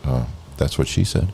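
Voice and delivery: quiet deadpan aside; hushed joke. Retold or imitated. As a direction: murmur it under the breath. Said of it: "Dave's gonna be like".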